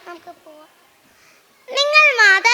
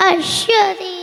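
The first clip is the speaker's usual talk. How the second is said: ordinary talk, nothing marked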